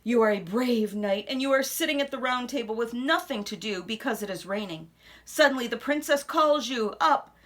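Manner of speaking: ordinary delivery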